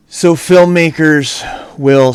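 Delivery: breathless after chasing a wasp; panting between words, slow; out of breath. Real, not acted. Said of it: "sorry that I'm out of breath".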